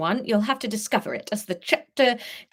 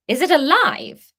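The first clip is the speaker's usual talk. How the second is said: high loud alarmed question; loud startled question; alarmed surprise; 8 semitones higher, 8 dB louder than usual. Acted. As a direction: blurt it in shock. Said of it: "eyebrows shot up".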